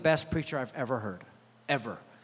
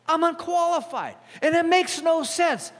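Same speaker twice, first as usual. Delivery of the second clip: excited; 12 semitones higher, 4 dB louder than usual. Acted. Preacher's rising declaration.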